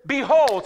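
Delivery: "Behold" called out loud and high with a falling finish; calling the church to repeat it; emphatic call. Shown as a demonstration. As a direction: proclaim "Behold" for the church to echo. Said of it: "Somebody say".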